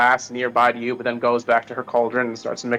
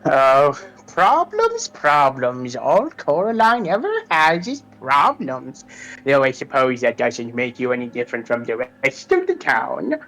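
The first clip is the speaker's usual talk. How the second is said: a hint of a mocking crone; witch voice; 2 semitones higher than usual. Witch scoffs at Coraline's problems in a lightly witchy voice.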